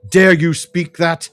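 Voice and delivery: bitter accusation. Acted. Edric blaming Eowyn for abandoning his people, loud bitter accusation. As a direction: throw the blame at her.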